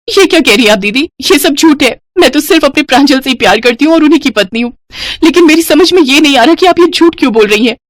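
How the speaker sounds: high, distressed wife; upset, high-pitched appeal; pleading denial. Acted. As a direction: protest your innocence. Wife pleads against false accusation.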